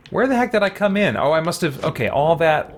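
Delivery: ordinary delivery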